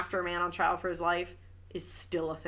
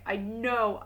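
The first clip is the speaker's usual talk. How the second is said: usual speaking voice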